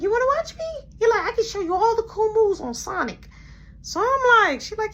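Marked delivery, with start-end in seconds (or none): 0.0-3.3 put-on much higher eager child voice; high kid voice showing off; kid brother voice. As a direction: play the excited little boy. Little brother voiced in story.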